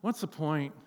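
low, soft, deflated murmur; deflated question. Acted. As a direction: ask it quietly, as if worn out. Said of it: "find yourself at the end of it just going".

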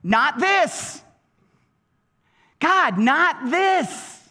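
high, emphatic cry to God; acted desperate cry, drawn out. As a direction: plead it out, pitched high. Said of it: "He's crying out".

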